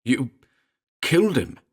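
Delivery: high, choked and strained; choking accusation. Acted. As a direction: force the words through a tight throat. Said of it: "Elliot choked".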